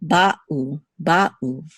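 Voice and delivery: ba'u with a catch between ba and u; distinct syllables. Shown as a demonstration. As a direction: break each ba'u in two like uh-oh. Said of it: "So same thing with Hebrew here".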